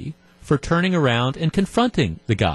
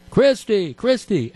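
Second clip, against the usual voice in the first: mock yelled name; 7 semitones higher, slower than usual. Fan calling Christie from thirty feet away, mock yell. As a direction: imitate calling after someone. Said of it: "I yelled his name again".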